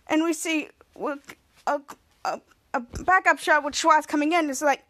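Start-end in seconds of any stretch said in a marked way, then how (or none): none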